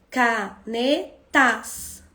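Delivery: canetas very slowly, ca-ne-tas; slow, chunked "Canetas" with pauses between syllables; split into syllables. Shown as a demonstration. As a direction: break "Canetas" into three spaced beats. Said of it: "Quantos pedacinhos tem?".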